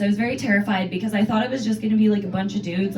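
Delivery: normal talking voice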